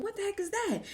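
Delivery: high, hushed bewildered question; baffled alarm. Retold or imitated. Family reacting to the baby's rolling eyes, baffled alarm. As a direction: retell a startled reaction, pitched high and soft.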